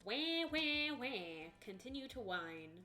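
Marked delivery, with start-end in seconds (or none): none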